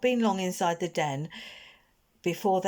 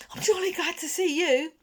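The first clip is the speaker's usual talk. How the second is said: put-on high-pitched, out-of-breath boy; relieved but still panting; breathless boy; 12 semitones higher than usual. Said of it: "panted Barney".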